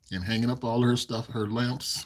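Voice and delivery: ordinary talk, nothing marked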